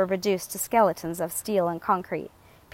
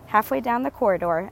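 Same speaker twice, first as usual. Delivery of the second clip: ordinary delivery, nothing marked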